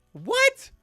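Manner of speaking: high, loud stunned What?; real shock at the number. Real, not acted. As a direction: blurt it out in disbelief. Shocked at sick day figure.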